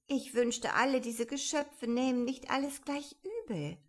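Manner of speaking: put-on a touch of girlish exasperation; aggrieved Alice. A narrator's reading. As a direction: wish it plaintively as young Alice. Alice thinks to herself, aggrieved.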